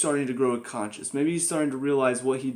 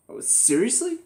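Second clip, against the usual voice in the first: higher, incredulous oh seriously; can't believe the writers did this; exasperated disbelief; 8 semitones higher than usual. Real, not acted. Exasperated reaction to show's choice.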